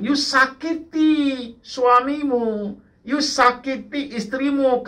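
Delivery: loud, high-pitched preaching; preaching at full force. Acted. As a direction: drive every line home to the congregation. (khotbah berapi-api)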